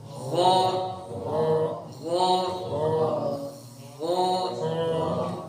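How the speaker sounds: غا held and voiced, softly sung out; lengthened voiced غا for the class; drawn-out ghain. Shown as a demonstration. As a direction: let غا ring in the throat, voice continuing. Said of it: "غور سے سنو اور اس طرح آواز بنانی ہے".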